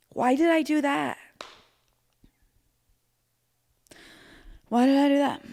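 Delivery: high-pitched wail of regret; hammed-up despairing cry; mortified self-reproach. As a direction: squeal it in agony at yourself. Horrified at her own blunder.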